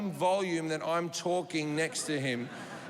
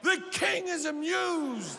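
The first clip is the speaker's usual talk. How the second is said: high, slow proclaiming herald; medieval herald; 9 semitones higher, slower than usual. Retold or imitated. Courtier proclaiming to the courtyard, high and grand. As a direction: announce it to the whole court. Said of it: "medieval film where the king".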